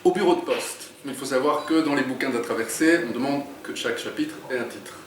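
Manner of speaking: lively read-aloud narration; story reading. A narrator's reading. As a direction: read the chapter to draw listeners in. Author reads his book aloud.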